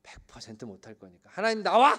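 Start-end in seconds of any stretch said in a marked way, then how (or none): 1.3-1.9 loud, higher mock shout at God; mock furious demand. Sarah challenging God in imagined anger, mock shouting. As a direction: shout as the outraged mother. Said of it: "이러지".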